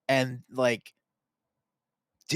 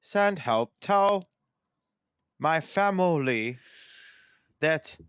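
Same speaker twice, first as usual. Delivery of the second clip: slow, halting robot with long pauses; raised, stilted and measured, word by word; sad robot; 4 semitones higher, 3 dB louder, slower than usual. Pretend. Co-host tells listeners they are held hungry, as a sad robot. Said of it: "You sound like a sad robot".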